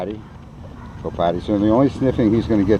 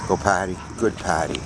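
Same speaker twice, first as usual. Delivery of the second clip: ordinary delivery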